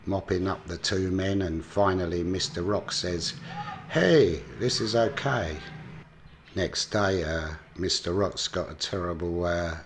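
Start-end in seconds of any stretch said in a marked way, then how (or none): 3.9-5.6 loud, high "hey" easing down; pleased discovery. Retold or imitated. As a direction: imitate someone suddenly delighted with things. Rock's pleased discovery, acted.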